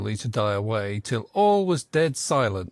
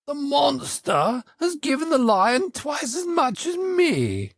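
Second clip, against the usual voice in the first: much higher aggrieved unicorn voice; the unicorn complaining; 12 semitones higher than usual. A narrator's reading. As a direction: whine it high like the cheated unicorn. Unicorn complains about unfair share.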